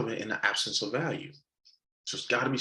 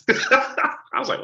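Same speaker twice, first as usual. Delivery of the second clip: laughing; 5 dB louder, slower than usual. Real, not acted.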